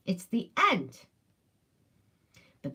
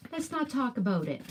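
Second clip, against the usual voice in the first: ordinary talk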